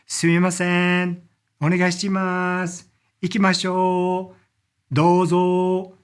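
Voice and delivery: sumimasen, onegaishimasu, ikimashou, douzo, each with its last sound drawn out; polite Japanese, stretching every ending a little; dragged word endings. Shown as a demonstration. Teacher models Japanese phrases, drawing out endings. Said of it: "they kinda like to drag out the very end".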